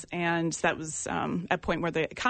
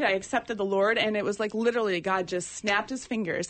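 ordinary talk

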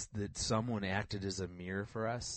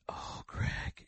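whispered sympathy. Retold or imitated. Mentor voiced in retold call.

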